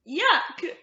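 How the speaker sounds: loud, high mock-disgusted yuck. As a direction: squeal it for the kids. Reacting to dragon's sloppy lick.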